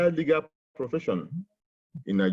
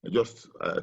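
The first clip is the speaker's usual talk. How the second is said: normal talking voice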